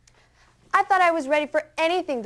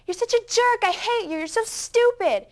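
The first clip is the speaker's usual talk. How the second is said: angry outburst; 11 semitones higher, 3 dB louder than usual. Retold or imitated. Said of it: "is all in her brother's face".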